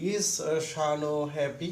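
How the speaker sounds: yes-no question ending on a rising tune. Shown as a demonstration. Teacher showing students a yes-no question, rising tone. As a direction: lift the end of "Is, uh, Shano happy?" as a question. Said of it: "your tone should be rising tone".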